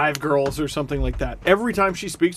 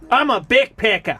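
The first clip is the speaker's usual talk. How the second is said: higher-pitched, bad Aussie impression; mock Australian accent; 4 semitones higher, 4 dB louder than usual. Host playing a backpacker for laughs, mock Australian accent. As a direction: put on a broad, hammy Australian accent. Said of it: "Australians, I'm so sorry".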